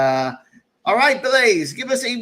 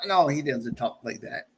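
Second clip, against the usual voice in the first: ordinary talk, nothing marked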